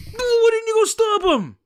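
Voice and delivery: very high, strained comic shriek; outraged mock yell. Teacher demanding why nobody stopped the vandal, shrieked outrage. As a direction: shriek it in exasperated disbelief. Acted reply of outraged Mister Little.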